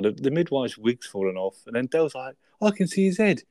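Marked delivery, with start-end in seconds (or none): 2.6-3.4 high, loud excited Del impression; excited high yelp. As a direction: blurt it out in a high, thrilled voice.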